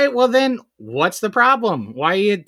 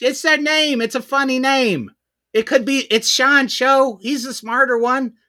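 high-pitched, exasperated defensiveness; defensive protest; 6 semitones higher than usual. Real, not acted. Host denying the show is his, defensive protest. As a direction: insist on it with the pitch pushed up.

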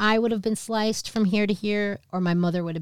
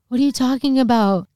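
put-on higher, louder bewildered kid; baffled retort; 6 semitones higher, 6 dB louder than usual. Child questions mom's revelation, retold bafflement. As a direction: demand an explanation, confused.

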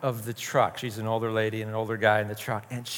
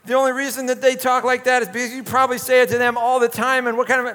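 high, louder mock telling-off; scolding parent; 11 semitones higher, 5 dB louder, faster than usual. A parent scolds the neighbor for the kid's swearing, high mock outrage. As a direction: pretend to chew out a neighbor parent. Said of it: "And, like".

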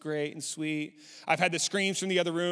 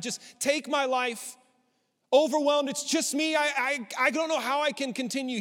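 anguished cry; 8 semitones higher, 4 dB louder than usual. Acted. Voicing Elijah crying out.

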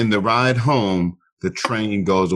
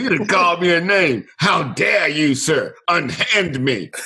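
hammed-up indignant gent, slightly higher; offended gentleman; 8 semitones higher, slower than usual. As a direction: play the wounded Victorian gentleman, slowing down. Mock offense at being insulted.

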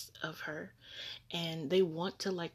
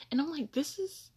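usual speaking voice

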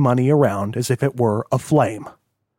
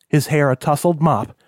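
ordinary delivery

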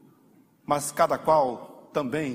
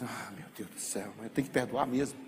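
much softer, a weary sigh of giving in; reluctant sigh; 5 semitones lower, 7 dB quieter than usual. Retold or imitated. Believer grumbling to God about having to forgive, softly sighing. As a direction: sigh as if forgiving were a heavy chore. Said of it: "Aí você diz".

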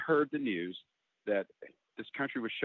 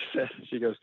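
usual speaking voice

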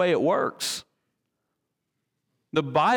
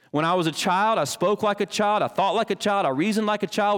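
raised, solemn reading of Paul; scripture reading; 6 semitones higher than usual. Acted. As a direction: read it as sacred text.

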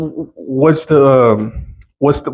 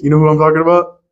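usual speaking voice

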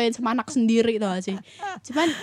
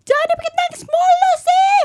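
very high, loud scolding shout; imitated yelling; 7 dB louder than usual. Relative downstairs telling mother to stop, high scolding yell. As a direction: yell up in exasperated reproach. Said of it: "orang bawah tinggal teriak".

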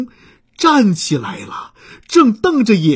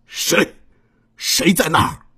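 scary voice; 3 semitones lower than usual. Acted. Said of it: "用很可怕的声音问".

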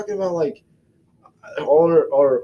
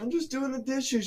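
meek mock reply; 11 semitones higher, 8 dB quieter than usual. Innocent reply, higher softer.

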